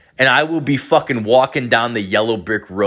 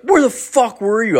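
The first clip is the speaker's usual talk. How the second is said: mock teammate yell; 12 semitones higher, 3 dB louder than usual. Teammates yelling at him.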